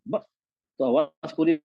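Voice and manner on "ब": ब chopped off by a glottal stop; closed vocal cords. Shown as a demonstration. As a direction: stop the breath dead after ब. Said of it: "vocal cord बंद हो गई". (ब के बाद आवाज बंद)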